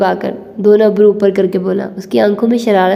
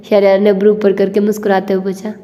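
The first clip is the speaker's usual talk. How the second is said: plain reading voice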